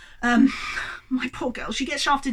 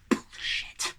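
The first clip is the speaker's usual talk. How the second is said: voiceless, much softer aside; whispered curse; 10 dB quieter than usual. Real, not acted. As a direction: hiss it under your breath. Muttered verdict after sarcastic rant.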